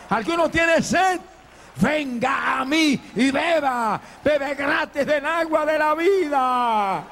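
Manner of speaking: high, ringing cries with long-drawn words; full-force preaching. Real, not acted. Preacher calls the thirsty to Christ, fervent and shouting. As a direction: cry out the altar call, voice pitched high.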